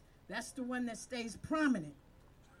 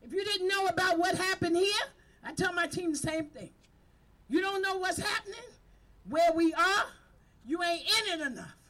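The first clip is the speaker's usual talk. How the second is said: fired-up preaching, louder, higher; rally them like a pulpit preacher; preaching at full force; 5 semitones higher, 7 dB louder than usual. Real, not acted. Community leader urging her outreach team, preaching at full force.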